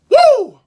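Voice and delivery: high, loud hyped woo; excited whoop. Real, not acted. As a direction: let out a pumped-up whoop. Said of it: "I got fucking excited".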